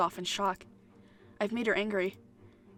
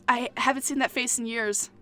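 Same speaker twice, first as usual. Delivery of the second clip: ordinary delivery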